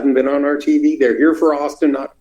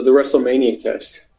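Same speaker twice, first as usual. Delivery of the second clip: usual speaking voice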